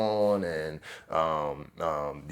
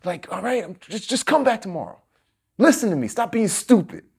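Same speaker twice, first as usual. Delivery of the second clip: high, louder impatient snap; half-acted scolding from the recruiter; snapping impatiently; 7 dB louder than usual. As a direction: cut him off, fed up. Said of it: "she snapped at me".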